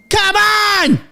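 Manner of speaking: high thrilled shout; excited shout. Real, not acted. A streamer reacting to breaking news with a thrilled shout. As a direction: yell it out in joyful disbelief.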